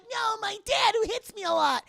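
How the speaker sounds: put-on high, loud pleading kid cry; whiny kid wail. Zach cries for his dad.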